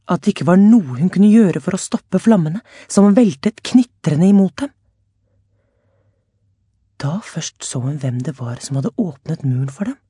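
plain reading voice

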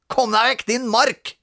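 high, loud yell at his brother; bossy big brother shouting. Acted. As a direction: shout him out of the room. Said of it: "ropte Robin".

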